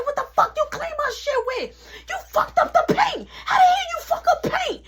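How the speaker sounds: hammed-up furious mother, barely holding a laugh; mom yelling. Mom yelling about paint.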